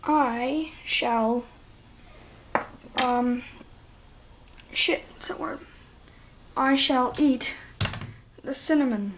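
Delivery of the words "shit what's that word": quick voiceless whisper to himself; breathy muttered aside, no voice. Real, not acted. Forgets the word mid-challenge.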